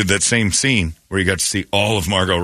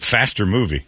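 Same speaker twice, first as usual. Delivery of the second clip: ordinary delivery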